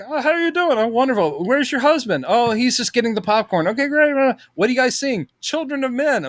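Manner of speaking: high chit-chat between celebrities; lightly voiced small talk. George and Bryce chatting in the lobby, lightly voiced high. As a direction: trade pleasantries in a lifted voice. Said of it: "it's like".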